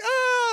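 a high, loud mock wail like a crying kid; imitated kid wail. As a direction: squeal out a child's whine.

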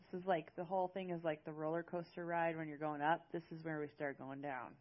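usual speaking voice

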